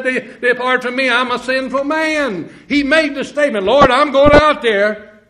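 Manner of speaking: high, loud preaching cadence; rising revival sermon voice; fervent preaching. Acted. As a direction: lift it into full fervent preaching. Preaching at full force.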